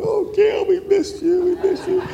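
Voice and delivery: very high, half-shouted tearful mock greeting; mock crying welcome. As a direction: ham up teary church folks calling out high. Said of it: "And they're crying".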